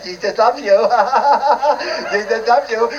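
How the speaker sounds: mocking sugary televangelist with a fake laugh; sing-song fake sweetness, giggling; plastic evangelical. Preacher mocking a plastic-smiling evangelist, saccharine laughing. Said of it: "with this plastic evangelical and charismatic smile".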